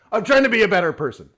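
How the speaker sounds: insistent, hurried near-yell. Real, not acted. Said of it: "I don't mean to yell".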